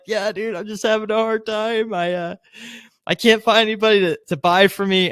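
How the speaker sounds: a touch of crying in the voice; mock-tearful complaint to the policeman; tearful retelling. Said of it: "sees that I'm crying".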